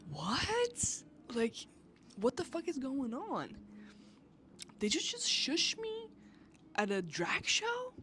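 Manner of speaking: high, shocked disbelief at being shushed; incredulous outrage. Retold or imitated. Retelling being shushed at a drag show, incredulous and high. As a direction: squeak out how dare you. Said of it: "And I'm, I'm just like".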